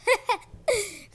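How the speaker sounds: high mocking laugh; villain laugh. A narrator's reading. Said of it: "Cronus laughed".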